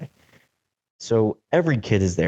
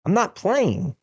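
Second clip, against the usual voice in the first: high-pitched imitation of a refusing girlfriend; squeaky, playful mimicry of her refusal; retelling how Adriana refused the kid, in a high mock voice; mock protest; 14 semitones higher than usual. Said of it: "She goes".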